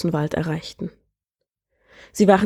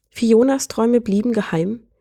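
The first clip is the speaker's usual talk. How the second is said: ordinary delivery, nothing marked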